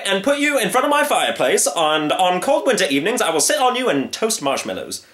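high, pompous tirade; emphatic, hammed-up hunter; blustering hunter. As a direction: lecture the lion theatrically. Hunter lectures surrendering lion.

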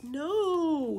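high, loud whiny no; whine. Acted. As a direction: whine like a child caught out. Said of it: "he whined".

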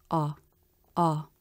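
"Ah" very short, low and flat; unstressed AH. Shown as a demonstration. Teacher showing unstressed syllables aren't as long, clipped low unstressed "ah". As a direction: drop each "ah" quickly, no stress. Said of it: "Function words are unstressed".

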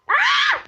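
high startled shriek; a sharp scream of surprise; piercing startled yell; sudden shriek. Real, not acted. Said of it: "Bağırdığım için kusura bakmayın".

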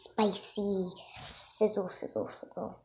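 breathy whispered sizzle sound effect; sizzling whisper. Acted. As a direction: hiss it out like food sizzling on a pan. Frog eats spicy food comic.

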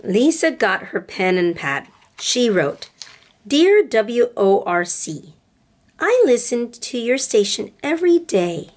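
gentle storybook read-aloud with voices; storybook reading for little girls; reading to children. A narrator's reading. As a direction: read it like bedtime story time. Reads picture book to girls.

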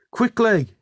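high, urgent call; urgent shout. A narrator's reading. Orville raises the alarm at the depot, urgent shout. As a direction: call out the alarm, pitched right up. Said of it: "he shouted".

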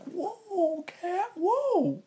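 very high mock alarm at a scratching cat; startled at the cat. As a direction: back off from the cat, half joking. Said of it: "I'm like".

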